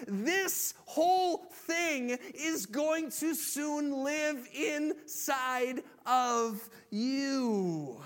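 loud voice. Acted. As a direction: announce it slowly and heavily. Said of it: "Jesus says in a loud voice".

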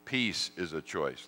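normal talking voice

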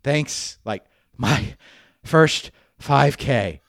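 gasping out words, short of breath; wheezy voice. Retold or imitated. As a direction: gasp it out, winded. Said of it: "I gasp out that".

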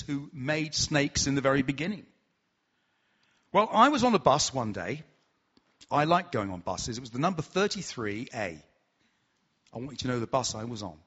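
ordinary talk

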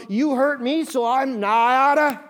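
hammed-up grudge; mock anger. Angry acted quote.

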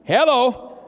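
ordinary talk

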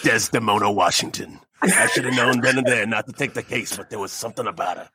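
low, brooding noir narration; gumshoe detective. Acted. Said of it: "I would do Gumshoe Detectives".

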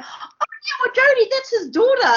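squeaky high retelling of Tom's reveal; high mock voice. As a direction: pipe it up high, teasing.

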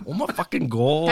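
lightly mimicked broad Aussie exclamation; playful mock-Australian; imitated Australian accent. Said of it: "best Australian accents I've heard".

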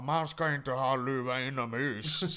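slow, lightly voiced scolding old man; lightly voiced old man scolding about a hat indoors; grumpy old man. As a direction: lecture him like a cranky old man. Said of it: "Han bara".

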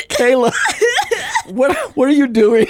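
amused director. Retold or imitated. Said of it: "I remember Joel saying".

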